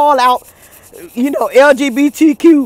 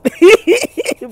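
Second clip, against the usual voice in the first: high tittering giggle; real giggle breaking out after talking; 8 semitones higher than usual. Real, not acted. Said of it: "don't want y'all to think I'm laughing".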